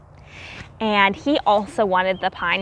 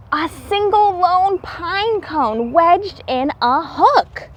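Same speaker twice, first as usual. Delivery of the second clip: lively read-aloud storybook voice, climbing higher at the discovery; storybook narration; 3 semitones higher than usual. A narrator's reading. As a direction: build the excitement for the children as the nuts appear.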